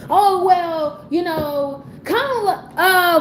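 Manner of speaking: high, slow, laughing mock answer; mocking politician dodge. Pundit mocks the candidate's evasive answer, high, slow and laughing.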